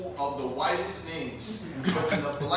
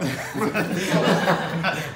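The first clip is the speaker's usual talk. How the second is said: laughter; 2 semitones higher, 8 dB louder than usual. Real, not acted. Laughs at own story.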